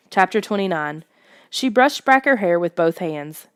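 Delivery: ordinary delivery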